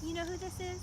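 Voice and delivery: put-on soft, gentle princess voice talking to children; storytelling queen speaking softly to kids; Elsa character voice. Plays Elsa at a kids' party.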